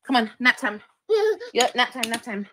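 loud, firm call of nap time. Real, not acted. Mother putting baby to nap.

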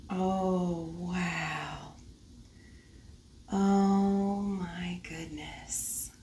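soft breathy whisper; awed whisper. Real, not acted. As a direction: whisper in wonder. Admiring the tapestry.

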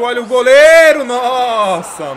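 loud, astonished outcry; amazed shout. Real, not acted. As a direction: burst out at the save. Amazed at goalkeeper.

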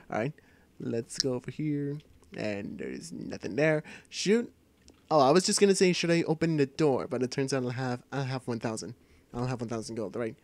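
normal talking voice

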